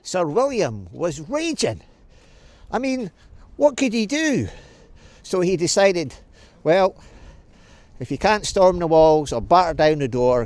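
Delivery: usual speaking voice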